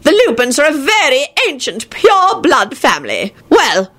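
put-on haughty, disapproving old wizard; scowling old man. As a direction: declare it with disdain. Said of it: "he scowled".

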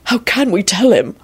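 high, grief-cracked question; voice cracking. A narrator's reading. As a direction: ask it helplessly, near breaking. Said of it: "Arthur's voice cracked".

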